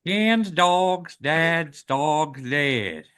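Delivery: nasal Texan. Shown as a demonstration. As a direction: aim the sound between your eyes. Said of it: "make the sound of your voice hit there".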